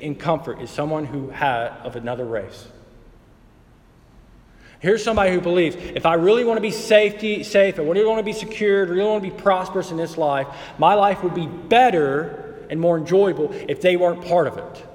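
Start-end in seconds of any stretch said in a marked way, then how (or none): 4.8-14.9 raised, higher voice as the self-serving believer; lightly voiced self-centered believer, higher and louder. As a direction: put words in the bigot's mouth, pushing up. Voicing a racist's inner thoughts.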